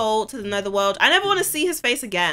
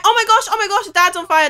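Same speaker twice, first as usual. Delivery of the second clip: loud, high, urgent alarm; high-pitched frantic repetition; panicked; 7 semitones higher, 6 dB louder, faster than usual. Real, not acted. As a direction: cry out as if someone is burning. Dad catches fire.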